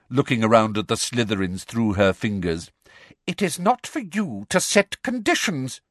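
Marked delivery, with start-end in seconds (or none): 3.2-5.8 high, stern rebuke; imperious scolding; harsh. A narrator's reading. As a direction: put her firmly in her place. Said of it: "said Professor Umbridge harshly".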